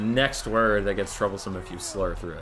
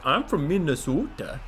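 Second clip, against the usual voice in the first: slow, exaggerated mock Canadian accent; 5 semitones higher than usual. Comedians mocking Minnesota as basically Canada, Canadian accent. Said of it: "they'll just do a Canadian accent".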